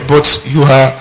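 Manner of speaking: ordinary talk, nothing marked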